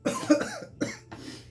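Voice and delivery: high strained cough; pained cough. Real, not acted. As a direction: cough from the burn. Pepper burning throat.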